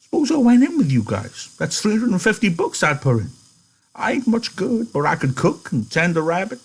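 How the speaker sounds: high-pitched, hopeful pleading; eager. A narrator's reading. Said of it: "he leaned forward eagerly".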